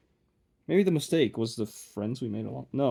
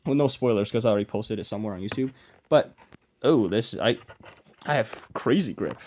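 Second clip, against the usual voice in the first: ordinary talk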